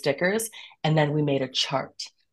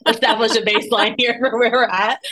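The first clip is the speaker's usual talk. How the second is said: talking through a real laugh; giggly, a little higher and louder; 7 semitones higher, 6 dB louder than usual. Real, not acted. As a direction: let the laugh spill into the words. Laughing while setting a baseline.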